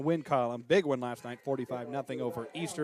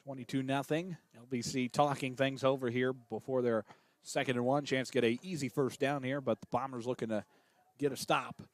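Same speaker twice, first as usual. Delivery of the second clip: steady radio play-by-play call, tracking each snap; live play-by-play. Acted. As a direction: call the game for listeners at home.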